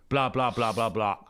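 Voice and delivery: dismissive mock chatter; mocking blah blah. Mocking Kid's boasts to the interviewer, dismissive. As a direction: brush off his words with scorn.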